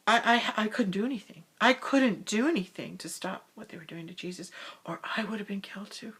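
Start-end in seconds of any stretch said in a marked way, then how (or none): none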